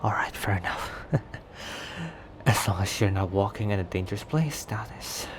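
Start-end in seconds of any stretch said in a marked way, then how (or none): 1.6-2.1 short, quiet, airy chuckle; quick soft huff of laughter. Acted. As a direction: let out a small laugh under the breath. Chuckles after conceding.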